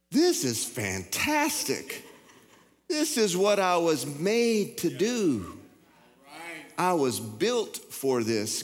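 slow, high, far-off wonder; elated baby bird. Pretend. Baby bird discovering it can fly, slow and high with wonder. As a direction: savor every word like first flight.

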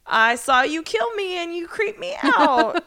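high-pitched mock outburst; suppressed freak-out. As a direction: imitate someone blurting out his horror, voice pushed high. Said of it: "trying really hard not to be like".

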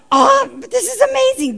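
high, laughing amazement; delighted laugh. Real, not acted. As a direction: burst out in delighted wonder. Preacher delighted by the story.

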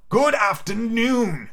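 high, very drawn-out farewell; insistent dismissal. Acted. Scrooge cutting off his nephew, slow high dismissal. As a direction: stretch it out to drown him out.

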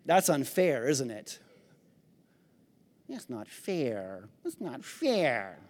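low, soft sulky complaint; mock whining. Preacher mocking the congregation's complaints, softly sulking. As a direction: pout like a child who got troubles. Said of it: "what kind of trouble".

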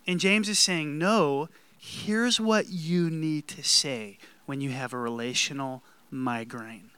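ordinary delivery

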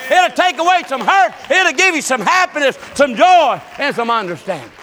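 building to high, loud revival cadence; full-force preaching. Real, not acted. Preacher telling congregation about the Holy Ghost, building fervent preaching. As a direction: drive each phrase up like a revival preacher.

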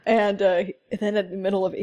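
ordinary delivery

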